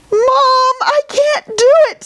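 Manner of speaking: very high, loud whining plea; wailing king. Acted. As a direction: whimper it like a crying child-king. King still crying to mother.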